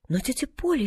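put-on mildly alarmed child's protest; frightened cry. A narrator's reading. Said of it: "испуганно крикнула Полианна". (испуганный возглас, негромко)

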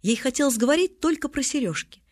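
ordinary delivery

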